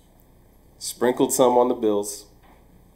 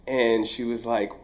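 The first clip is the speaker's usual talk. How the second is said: ordinary delivery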